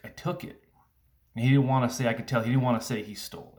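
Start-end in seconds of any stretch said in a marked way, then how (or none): 0.1-0.6 put-on a small guilty whisper from the boy; whispered confession. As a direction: breathe it out like a scared child admitting it. Son's tearful whispered confession.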